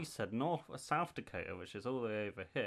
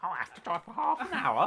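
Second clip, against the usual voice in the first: loud, higher-pitched mock moan; complaining British person; 5 semitones higher, 7 dB louder than usual. Player mimicking British people on distances, mock complaining. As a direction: act out an exaggerated grumble about a journey. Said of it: "You talk to a British person".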